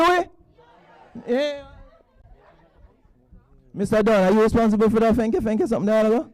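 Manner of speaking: ordinary delivery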